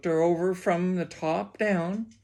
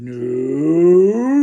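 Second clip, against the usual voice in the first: long no swelling much louder; loud refusal; 13 dB louder than usual. A narrator's reading. Father refusing to pay the cashier, hollering. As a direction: stretch it and let it rise to a holler.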